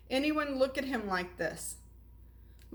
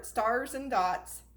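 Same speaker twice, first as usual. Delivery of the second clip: plain reading voice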